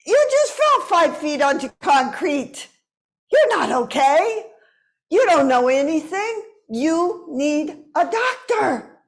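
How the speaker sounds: scolding aunt. Retold or imitated. Aunt's voice in her head, scolding her after the fall. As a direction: nag like a worried aunt who knows best. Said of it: "my aunt's voice comes into my head".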